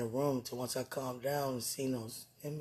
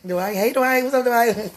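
much higher and louder, giddy calls; playful calling out; 9 semitones higher, 15 dB louder than usual. Real, not acted. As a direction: call his name out teasingly. Playfully calling his name.

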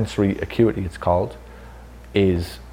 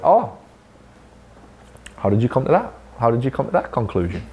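mock surprise; 4 semitones higher than usual. Retold reply to pay demand.

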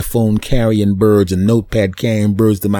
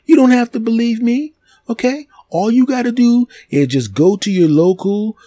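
insistent; 10 semitones higher than usual. Acted. Insists in raised voice.

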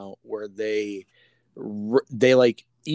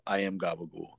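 low, softer imitation of a male reply; mock-solemn quote; 8 semitones lower, 8 dB quieter than usual. Tony Soprano answering a character in a meme, mock-solemn. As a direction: drop low for the punchline.

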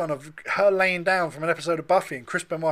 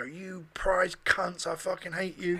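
drawn-out, muttered mock hate mail; slow muttered insult; 8 dB quieter, slower than usual. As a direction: read the hate mail slowly and quietly. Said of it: "email in and go".

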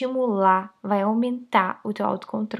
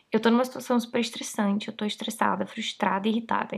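ordinary delivery

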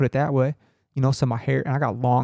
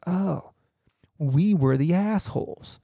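a drawn-out, slightly higher "oh" as it sinks in; slow dawning realization; 5 semitones higher, much slower than usual. Retold or imitated. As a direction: let the realization land slowly, half amused. Sudden realization about the app.

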